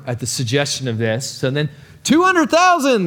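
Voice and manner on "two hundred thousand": much higher, louder desperate yell; yelling the bid. Acted. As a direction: yell it across the auction. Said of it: "the guy yells out".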